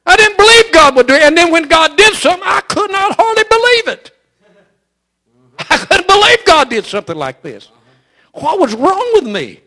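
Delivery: loud, rising preacher's cadence; full-force preaching. Acted. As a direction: drive it home to the congregation.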